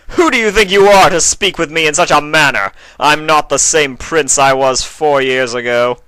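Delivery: loud, high furious roar; shouting. A narrator's reading. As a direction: bellow down at her in rage. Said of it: "shouted at her over her tears".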